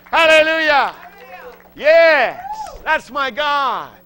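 loud, high praise, slowing on my God; preaching triumph to the congregation; joyful shout. Real, not acted.

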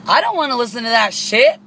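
high, loud snotty whine; mocking her voice. Imitating the driver complaining about the music, high and snotty. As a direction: mimic her rude complaint. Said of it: "She goes".

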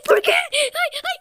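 high, loud wailing whine; mock-anguished wail. Real, not acted. Gamer lamenting a bad move to viewers, high wail. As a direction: cry out "why" in high-pitched anguish. Despairing over a failed game move.

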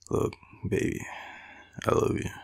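usual speaking voice

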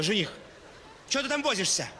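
normal talking voice

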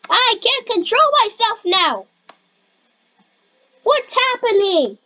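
high, stretched cries of alarm; panicked wail. Pretend. Player losing control in the game, panicked wail.

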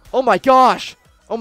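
ordinary talk, nothing marked

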